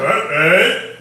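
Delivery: loud, mock rabbinic "no-no"; imitated rabbi's loud reprimand; scolding rabbi. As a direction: cut them off like a disapproving rabbi. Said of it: "The rabbi said".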